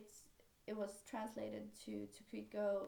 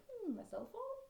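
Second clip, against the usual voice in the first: intrigued hmm; 12 semitones higher than usual. Retold or imitated. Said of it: "They were like".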